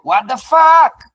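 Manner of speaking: high, loud, slow shout; mock yell. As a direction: yell it at the trader in mock anger. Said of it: "And I'm yelling at you".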